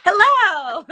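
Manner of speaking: loud, high cheery hello; excited greeting. Real, not acted. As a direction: burst in with a bright hello. Enters interview, loud greeting.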